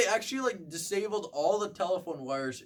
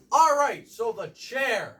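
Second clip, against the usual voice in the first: loud and high, then slow; loud projecting voice; 9 semitones higher, 9 dB louder, slower than usual. Retold or imitated. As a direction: project it to the back row. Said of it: "I was the only one that the audience could hear".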